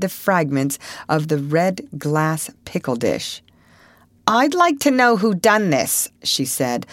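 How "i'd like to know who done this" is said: stern. A narrator's reading. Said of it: "looking sternly".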